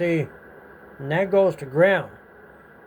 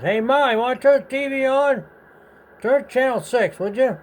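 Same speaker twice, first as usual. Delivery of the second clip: higher and louder, calling across the house; 9 semitones higher, 4 dB louder than usual. Retold or imitated. Boy calls to his mother to switch the TV on, calling out loudly. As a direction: raise your voice so Ma hears from the other room.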